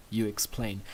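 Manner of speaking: ordinary delivery, nothing marked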